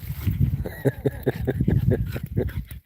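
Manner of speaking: breathy silent laugh. Real, not acted. Laughing at the dog.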